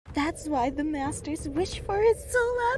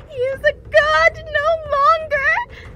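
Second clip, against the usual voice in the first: high, loud proclamation; loud, high-pitched defiant announcement; forceful declaration; 9 semitones higher, 9 dB louder than usual. Acted. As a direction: raise the voice and ring it out. Fae envoy's defiant declaration.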